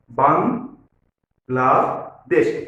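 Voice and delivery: বাংলাদেশ in three drawn-out syllables, pausing between each; teacher slowly splitting বাংলাদেশ into its three syllables. Shown as a demonstration. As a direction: say বাংলাদেশ very slowly as বাং, লা, দেশ. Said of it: "একটু ধীরে ধীরে উচ্চারণ করব".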